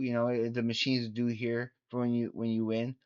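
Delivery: ordinary talk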